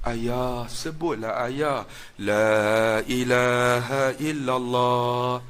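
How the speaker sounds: slow, soft, sorrowful chant-like plea; mournful plea. Retold or imitated. Son pleading softly to his dying father, sorrowful. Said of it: "dia buat nada sayu".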